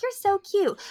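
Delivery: high gushing coo; gushing admirer. Retold or imitated. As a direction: coo it like a smitten fan. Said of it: "or, like".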